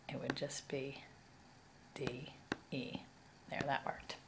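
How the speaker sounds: very soft, half to oneself; quiet self-talk. Real, not acted. Teacher writing out letters for the screencast, quiet self-talk. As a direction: say it under the breath while writing.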